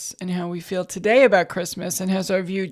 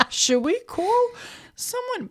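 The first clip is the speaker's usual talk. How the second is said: mock worried; slower than usual. Mock worry about dad.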